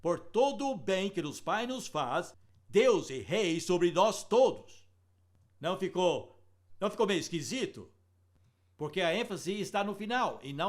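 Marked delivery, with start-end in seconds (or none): 0.0-4.9 slow, stress falling on the line end, not "Deus"; sing-read it slowly with the accent misplaced; wrong stress. Shown as a demonstration. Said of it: "Vou dar um exemplo". (ênfase na sílaba errada, lenta, final forte)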